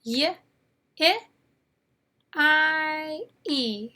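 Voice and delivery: "Y, I, E" as isolated sounds with long pauses; reciting letter sounds. Shown as a demonstration. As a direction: sound out "Y, I, E" one by one. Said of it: "Those are the four sounds".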